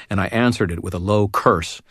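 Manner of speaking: ordinary delivery, nothing marked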